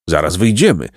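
plain reading voice

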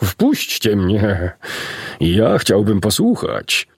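slow drawn-out horse voice; horse calling. A narrator's reading. Lame horse at the window begging to listen, slow horse voice. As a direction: call through the window like an old horse. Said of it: "jakiś głos zawołał".

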